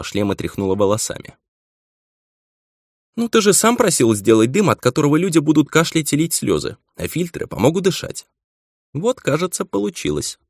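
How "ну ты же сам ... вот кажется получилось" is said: put-on higher, lively witch girl; young witch voice. A narrator's reading. Witch Tiri speaks.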